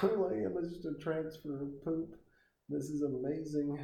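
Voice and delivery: put-on girl in the middle cheering about being a transfer. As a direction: squeal it out, falsely thrilled.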